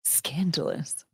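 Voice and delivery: playful scandalized murmur; mock scandalized. Real, not acted. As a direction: savor the gossip slowly. Reacting to the party story.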